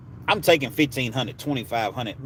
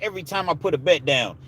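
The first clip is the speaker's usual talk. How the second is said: ordinary delivery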